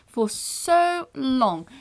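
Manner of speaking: milk the wait; drawn out. Real, not acted. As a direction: stretch for so long slowly. Exaggerated stretched complaint.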